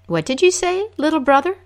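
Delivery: high curious Coyote voice; trickster tale voices. Acted. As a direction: pitch up, eager and puzzled. Coyote asks again.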